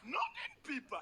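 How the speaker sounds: high, exclaimed mock surprise; hammed-up prim exclamation. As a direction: pitch it up like a scandalized Frenchwoman. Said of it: "Elles adorent les pipes".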